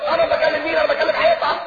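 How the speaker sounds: husband complaining he is talking to a wall; annoyed husband. Retold or imitated. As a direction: play the irritated husband who feels ignored. Husband quoted complaining angrily.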